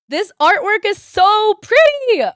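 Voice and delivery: high, loud and slow, a real squeal of delight; excited scream. Real, not acted. Streamer gushing to viewers over a gorgeous artwork, screaming with delight. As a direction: shriek it out, stretching every word. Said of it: "I know I'm screaming".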